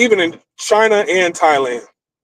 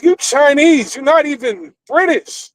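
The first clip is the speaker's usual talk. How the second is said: incredulous retort; 10 semitones higher than usual. Retold or imitated. Mocking the snobby date.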